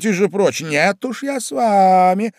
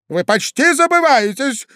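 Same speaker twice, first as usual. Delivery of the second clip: high, pleading cry of a desperate man; desperate outcry; 9 semitones higher than usual. Acted. Old gentleman cries out at the young man, desperate. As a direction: cry out in despair. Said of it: "закричал господин в енотах в отчаянии".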